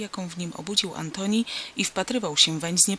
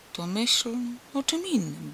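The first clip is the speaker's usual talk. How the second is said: half-hearted mumble, no belief in it; mumbled without conviction; 4 semitones higher than usual. A narrator's reading. Antoni to the crippled boy, mumbling without conviction. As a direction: offer the advice weakly, as if you doubt it yourself. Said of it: "bąknął bez przekonania".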